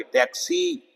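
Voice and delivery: टैक्सी said with a high long ee at the end. Shown as a demonstration. Teacher shows the long ee, rising on टैक्सी. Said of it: "long ई की sound आएगी".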